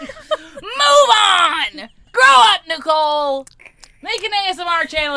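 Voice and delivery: hammed-up scolding yell; mock shout. Real, not acted. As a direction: yell at her in fun.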